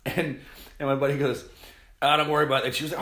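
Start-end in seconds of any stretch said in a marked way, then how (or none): 2.0-2.8 retold brush-off. Buddy brushing off broken glass.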